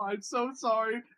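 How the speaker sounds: ordinary delivery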